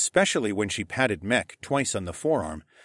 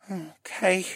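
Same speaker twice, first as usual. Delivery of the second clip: mumbled; 10 semitones higher than usual. A narrator's reading. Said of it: "he mumbled".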